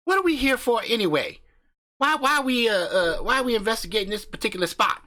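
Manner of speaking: brash country husband hyping up his channel; cocky backwoods YouTuber swaggering through the intro; crude hillbilly husband. Acted. Husband asks about the spot.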